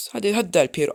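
ordinary talk

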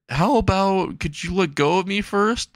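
high, strained plea. Acted. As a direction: squeak out the request. Izuku squeezed in hug.

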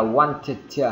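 "wanted" slowly as "want-tuh-tuh"; doubled T sound. Shown as a demonstration. Teacher explaining two identical sounds meeting, doubled T. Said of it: "hai cái âm là giống nhau".

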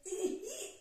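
high frightened eek; mock squeak of fright; startled squeal. Shown as a demonstration. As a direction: squeal at a lizard. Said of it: "इस तरह से जो sound निकलते हैं".

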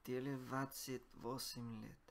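ordinary delivery

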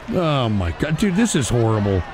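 low, deflated moan; dismayed groan. Real, not acted. Streamer groaning at what he has to watch, deflated. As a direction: let it all sag.

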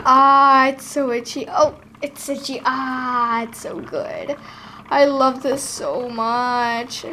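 ordinary talk

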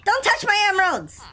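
angry shout. Real, not acted. Snatching back his emeralds.

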